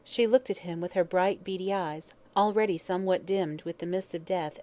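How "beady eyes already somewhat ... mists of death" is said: ordinary delivery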